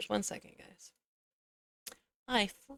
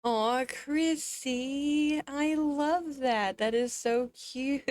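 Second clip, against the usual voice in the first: gushing; 7 semitones higher, much slower than usual. Real, not acted. Gushing over a viewer's emote.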